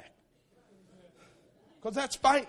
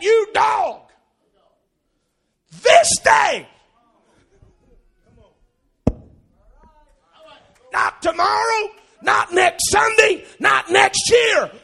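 loud, high shouting, slower and heavier on each "not"; defiant battle cry; 9 semitones higher, 13 dB louder, slower than usual. Retold or imitated. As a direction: build to a roaring "this day". David defying Goliath.

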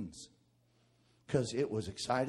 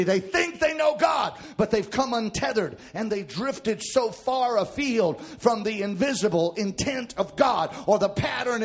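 preaching; 8 semitones higher, 12 dB louder than usual. Acted. Preaching at full force.

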